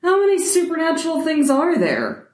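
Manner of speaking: high, insistent question; demanding. Acted. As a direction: press hard for an answer. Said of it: "Nate demands".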